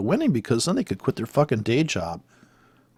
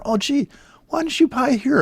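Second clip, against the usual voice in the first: higher, mocking "oh gee"; sarcastic; 12 semitones higher than usual. Real, not acted. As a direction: scoff at them sarcastically. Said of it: "sarcastic retort".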